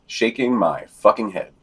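ordinary delivery

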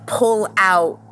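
ordinary delivery

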